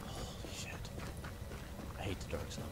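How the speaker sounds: hushed, soft whisper; sneaking gamer whisper. Real, not acted. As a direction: whisper so the game won't hear. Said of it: "I think if I whisper".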